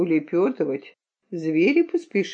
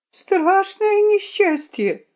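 very high, slower wail; sobbing; 13 semitones higher, slower than usual. A narrator's reading. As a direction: draw out the boy's wail even higher-pitched. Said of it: "зарыдал мальчуган". (тонкий тягучий рёв мальчика)

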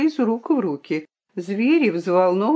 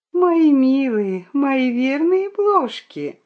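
tender; 6 semitones higher, slower than usual. Acted. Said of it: "прошептала".

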